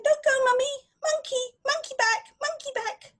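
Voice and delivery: put-on high, loud begging child voice; the child begging mummy not to leave, high and pleading. A narrator's reading. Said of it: "the child was going".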